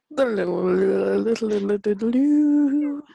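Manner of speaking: sung drum roll, rising and loud. Pretend. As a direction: hum a playful drum roll.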